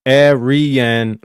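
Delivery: "Arian" stretched as air-ee-an; air sound. Shown as a demonstration. Streamer showing the shared first sound, air sound. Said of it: "or air sound".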